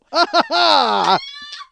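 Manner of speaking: big howling laugh; shrieking laugh; loud burst of laughter. Real, not acted. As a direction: crack up loudly at the punchline. Cracking up at own story.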